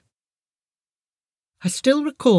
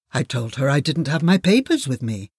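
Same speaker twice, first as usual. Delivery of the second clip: usual speaking voice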